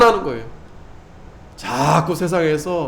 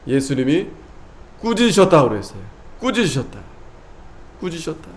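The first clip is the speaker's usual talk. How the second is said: ordinary talk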